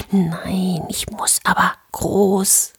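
slow, desperate whisper. A narrator's reading. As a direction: plead it under your breath. Brother whispers in hiding.

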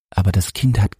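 ordinary delivery

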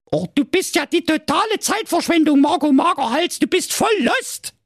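very high, sugary princess voice; sweet on top, cutting underneath; sweet-voiced princess. Princess insults Marco in a sugary high voice. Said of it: "sagte die Prinzessin mit lieblicher Stimme".

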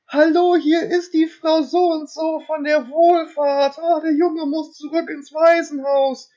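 very high, drawn-out falsetto; badly disguised man squeaking as a lady in falsetto; man faking woman's voice. Man posing as welfare lady at the door, badly faked falsetto. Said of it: "ein Mann, der die Stimme verstellt".

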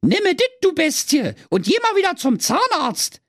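put-on high, cheeky Berlin girl voice; little girl Ute. Ute hands the moon rolls, high cheeky girl voice. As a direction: play the sassy little girl, pitched high.

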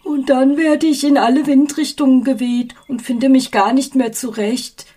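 a high, loud whiny lament; complaining. A narrator's reading. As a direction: wail about his troubles. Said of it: "klagte die blasse Aschewolke".

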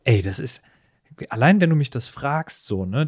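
ordinary delivery

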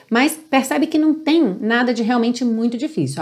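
ordinary delivery

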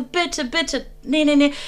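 whiny begging friend. Retold or imitated. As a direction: beg like an impatient friend. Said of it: "Sie so". (gespielt quengelndes Betteln der Freundin)

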